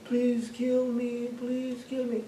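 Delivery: high, slow, drawn-out plea; retold weak, high-pitched pleading; feeble pleading. Dying woman pleads to die.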